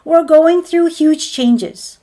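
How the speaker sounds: a slow, firm declaration; emphatic insistence. Real, not acted. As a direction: hammer it home, each word weighed. Emphatic rebuttal of deniers.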